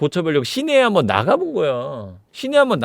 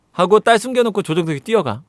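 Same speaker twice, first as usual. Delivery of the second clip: usual speaking voice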